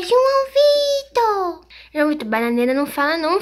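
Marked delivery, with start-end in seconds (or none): 0.0-1.7 long, high drawn-out call. Real, not acted. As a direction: call his name across the house. Mãe chamando o filho. (chamando alto e arrastado)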